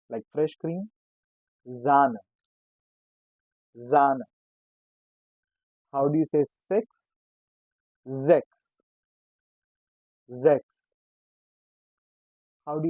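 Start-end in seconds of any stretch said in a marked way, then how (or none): 1.7-4.2 Sahne said "ZAH-neh", twice; Sahne, S sounded as z; German pronunciation. Shown as a demonstration. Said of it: "S sounds as". 8.1-10.6 Sechs said "zeks", louder; German pronunciation. Shown as a demonstration. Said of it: "sounds as Z".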